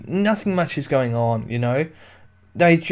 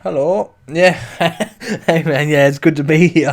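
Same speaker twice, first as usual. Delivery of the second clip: oddly loud, put-on hello; weird greeting voice; 2 semitones higher, 5 dB louder than usual. Real, not acted. Guest greeting the host in an odd, louder put-on voice. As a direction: blurt the greeting out in a strange put-on voice. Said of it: "I don't know why I said it like that".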